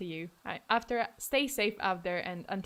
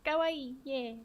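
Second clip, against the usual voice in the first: put-on high, cutesy anime-girl lilt; 3 semitones higher, 5 dB quieter than usual. Shown as a demonstration. Streamer showing chat her voice can be cute, cute voice. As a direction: make it small and adorable. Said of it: "I can make my voice cute".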